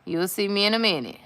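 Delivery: mock-repeated shout to the camera; repeating a shout. Woman repeating to viewers what she shouted to her partner. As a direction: say it again as she'd called it. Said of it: "I said".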